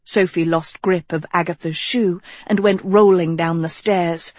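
ordinary delivery, nothing marked

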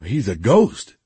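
mildly shocked gasp; a touch of astonishment. A narrator's reading. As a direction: say it in startled disbelief. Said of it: "Another person gasped".

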